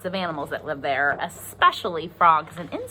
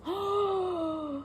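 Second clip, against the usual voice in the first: dramatic gasp; 10 semitones higher, 7 dB quieter than usual. Acted. Scary reveal in story.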